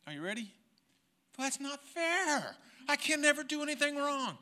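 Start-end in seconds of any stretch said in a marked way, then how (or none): 1.4-4.4 put-on high, slow, whiny child protest; indignant child. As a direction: protest like a little kid caught out. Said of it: "the first words came out of my mouth".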